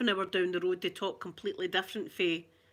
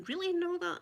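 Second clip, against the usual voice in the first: much higher, wide-eyed mock surprise; imitating surprised tourists; 10 semitones higher than usual. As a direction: play the amazed tourists. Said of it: "And they were going".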